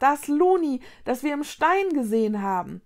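loud start, easing off; blurted out. A narrator's reading. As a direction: burst out in recognition, then settle. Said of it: "entfuhr es Konrad".

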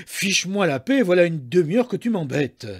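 exasperated husband's complaint; irritated outburst. A narrator's reading. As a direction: tell them off, annoyed. Annoyed husband snaps at wife.

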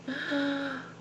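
high, sharp intake of breath; real gasp, overcome with feeling; emotional gasp. Real, not acted. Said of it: "I'm getting emotional now".

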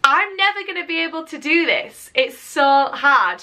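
high-pitched mock despair; hammed-up overwhelmed protest, rising high; recalled despairing thought. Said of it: "I remember thinking".

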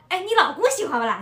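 asked in lively Shanghainese dialect. Retold or imitated. A friend asks about the husband, in Shanghainese. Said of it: "然后我闺蜜说".

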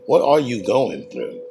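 normal talking voice

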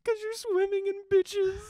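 very high, very loud mock female voice; woman's shouted reply. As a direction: shriek the punchline as the woman. Woman's shrieked answer, retold.

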